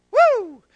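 high, loud "woo"; excited whoop. Real, not acted. Preacher thrilled by the scripture, whooping. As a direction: whoop loudly as the feeling takes you.

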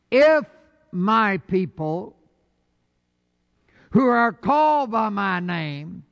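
a high, loud burst on If; emphatic shout. Acted. Pastor opening the Bible verse to the congregation, shouting. Reading scripture, big opening.

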